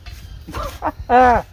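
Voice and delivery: high burst of giggly laughter; helpless, high-pitched chuckle; real laughing fit. Real, not acted. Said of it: "I don't know why I'm laughing so much".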